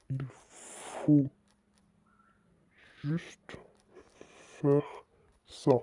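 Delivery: soft, halting, one word at a time; slow dictation. Real, not acted. Streamer typing instructions for viewers, soft word-by-word dictation. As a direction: murmur each word while typing.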